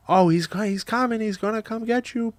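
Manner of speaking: imitated small child, very high voice; baby Zoe. Parent imitating daughter replying to Nemo, high toddler voice. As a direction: squeak it out as an eager little kid. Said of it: "baby Zoe will be like".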